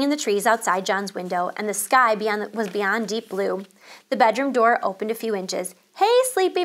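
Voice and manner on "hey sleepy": high, sing-out wake-up call; mother calling from the doorway. A narrator's reading. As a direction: call to a sleepyhead. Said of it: "Missus Midas called".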